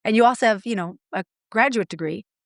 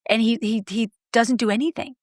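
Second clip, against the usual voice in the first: usual speaking voice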